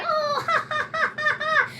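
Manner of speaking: high, mock ha-ha-ha; mocking fake laugh. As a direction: imitate someone laughing, pitched high. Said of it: "kind of funny when you hear from the most litigious person in the world saying".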